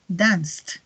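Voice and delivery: "danced" as one syllable ending in t; final T. Shown as a demonstration. Teacher drills past forms, "danced" ending in a clear T. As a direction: add a released T to close "danced". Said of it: "Al final suena como una T".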